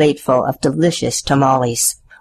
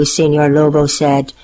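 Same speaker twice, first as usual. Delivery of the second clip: ordinary delivery, nothing marked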